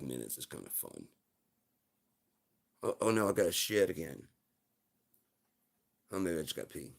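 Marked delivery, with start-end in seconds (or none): none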